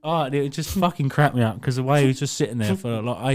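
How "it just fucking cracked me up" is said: usual speaking voice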